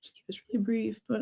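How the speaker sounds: breathy whisper; half-voiced whisper. Real, not acted. Throat trouble, drops to whisper.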